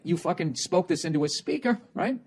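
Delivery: usual speaking voice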